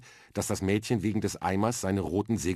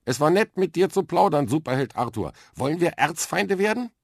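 much louder and higher, bright and playful; cheerful calling out; 10 semitones higher, 8 dB louder than usual. Acted. Mia cheerfully proposes to be Arthur's arch-enemy, loud. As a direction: call out gleefully as you leave.

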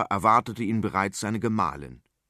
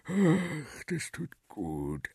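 slow, soft sigh of relief; 5 semitones higher, 4 dB quieter, much slower than usual. Acted. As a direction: sigh the words out. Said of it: "seufzte Ladislaus".

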